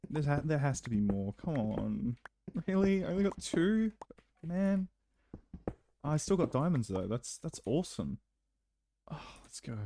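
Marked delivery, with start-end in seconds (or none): 2.7-4.9 high-pitched, let-down disbelief; disbelieving. Real, not acted. Finds only two diamonds. 9.1-9.8 hushed, breath-only celebration; whispered cheer. Real, not acted. Quiet celebration after the yelling.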